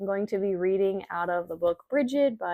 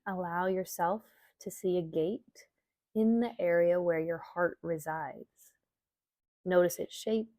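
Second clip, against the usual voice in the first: calm, measured meditation reading with long pauses; guided meditation; 3 dB quieter than usual. Acted. Yoga teacher guiding listeners through an Imbolc visualization, meditation voice. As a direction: guide listeners gently inward, leaving space between phrases.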